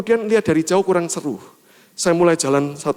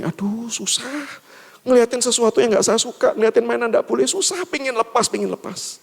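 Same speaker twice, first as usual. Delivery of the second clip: higher-pitched mock grumble; child's complaint; 6 semitones higher than usual. Boy fed up with having his hand held, acted high grumble. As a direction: grumble like a child wanting free.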